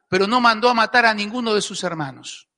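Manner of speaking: usual speaking voice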